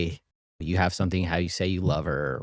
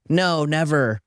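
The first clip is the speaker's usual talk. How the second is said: lightly voiced daughter, higher pitch; 6 semitones higher, slower than usual. Daughter reassuring mom about daddy's love, lightly imitated, higher. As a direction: answer as the daughter, a touch higher. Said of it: "She's like".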